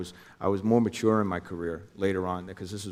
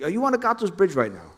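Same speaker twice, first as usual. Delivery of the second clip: much higher, urgent voice for Jimmy; imitating Jimmy calling, pitched way up; Jimmy on the phone; 11 semitones higher, 5 dB louder than usual. Jimmy calls him on phone.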